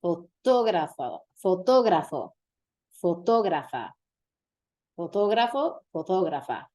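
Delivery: fotógrafo with the TO stressed; fotógrafa, stressed hard on TO. Shown as a demonstration. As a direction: lean on the middle syllable TO in fotógrafo. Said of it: "notice the emphasis there".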